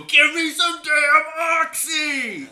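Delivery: high, drawn-out desperate shout; hammed-up begging scream, stretched out; desperate demand. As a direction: yell it like you've grabbed someone by the collar. Acts grabbing a doctor, demanding drugs.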